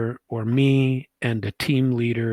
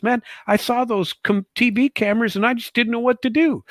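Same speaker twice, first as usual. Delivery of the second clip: higher-pitched, sheepish explaining voice; a mock nervous colleague, voice pitched up; flustered excuse; 11 semitones higher than usual. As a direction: imitate a rattled guy making excuses. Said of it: "He goes".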